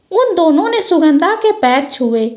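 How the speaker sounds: ordinary delivery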